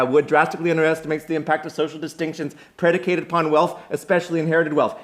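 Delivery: loud, high, fast recitation; mocking scholar. Will cuts in on the grad student's quotation, loud rapid recital. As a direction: reel off the quotation at full tilt. Acted academic quarrel, cutting in.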